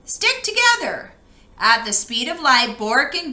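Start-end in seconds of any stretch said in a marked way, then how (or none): 0.1-1.1 urgent call. Acted. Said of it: "called Bork".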